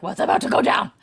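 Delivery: loud, hammed-up nervous question; mock nervous. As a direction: play up the worry about what's coming. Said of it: "always nervous about something".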